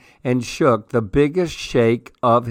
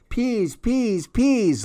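put-on very high, soft toddler voice; baby talk; 11 semitones higher, 3 dB quieter, much slower than usual. A narrator's reading. Baby Louie copying his sister's begging, tiny high voice. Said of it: "Louie said".

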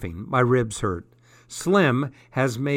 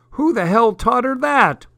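high, loud indignant demand; outraged dad; 11 semitones higher, 6 dB louder than usual. A narrator's reading. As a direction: explode at the question, pitch shooting up. Dad outraged by daughter's swearing.

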